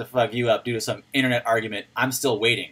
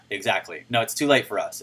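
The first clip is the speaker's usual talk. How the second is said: usual speaking voice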